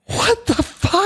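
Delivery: high, slow, drawn-out gasp of disbelief; stunned disbelief. Real, not acted. Listener floored by the vortex story, high and slow. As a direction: let it hang, genuinely floored. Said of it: "Holy shit".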